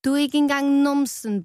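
tomato mocking Rød; teasing. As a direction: deliver the final jab gleefully. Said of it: "sagde en tomat".

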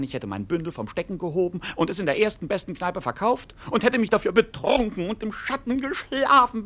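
ordinary talk, nothing marked

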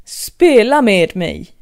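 loud, slow royal command; a loud slow imperious queen demand. Acted. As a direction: order her like a queen. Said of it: "befallde drottningen".

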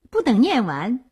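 ordinary delivery, nothing marked

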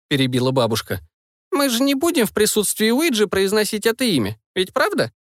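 a hint of a wise old witch; old woman voice. Grandmother speaking.

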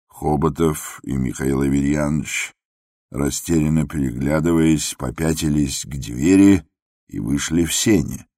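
ordinary delivery

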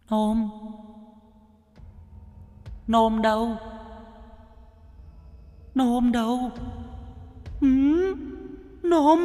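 high, soft eerie calls; piercing ghost voice. A narrator's reading. Drowned woman's ghost calls for Nôm, piercing eerie voice. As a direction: call faintly from beyond, thin as tearing silk. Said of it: "Âm thanh thánh thót như xé lụa".

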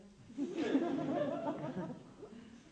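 laugh. Real, not acted. Laughs after "che cosa tremenda. (risata spontanea)

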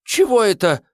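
high frightened squeak. A narrator's reading. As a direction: squeak it out in panic. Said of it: "пискнул Рыжик". (испуганный писк)

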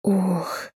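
high, soft gasping moan; a short breathy moan. Acted. Moan during intimacy. (тихий стон)

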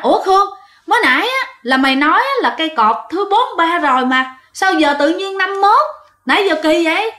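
high-pitched, puzzled challenge; baffled questioning. Acted. Friend questions Khương about the post number, baffled. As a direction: question a friend in rising disbelief.

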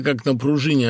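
plain reading voice

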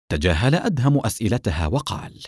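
ordinary delivery, nothing marked

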